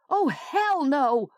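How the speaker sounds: slow, high, emphatic refusal; woman refuses the strangers, a high drawn-out yell. A narrator's reading. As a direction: drag out each word in high outrage. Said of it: "she yelled".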